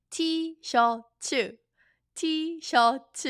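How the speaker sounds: T-shirt said as "tee-shuh", high and clear; Korean T-shirt. Shown as a demonstration. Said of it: "just use the Korean accent".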